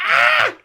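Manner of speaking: very high, loud mock wail; hammed-up wail of heartbreak; overemotional cry. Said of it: "I'm getting all super fucking emotional".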